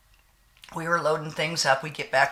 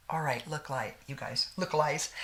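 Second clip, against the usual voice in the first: normal talking voice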